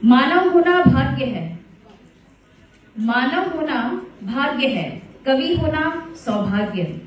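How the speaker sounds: lightly recited couplet, words drawn out; poem recitation. Acted. Host recites a couplet to the audience, poem recitation. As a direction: recite it to the audience with gentle pride.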